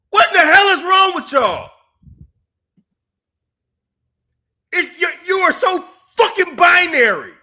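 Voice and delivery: snapping at the audience; angry shouting. Real, not acted. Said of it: "Yes, I'm snapping on you".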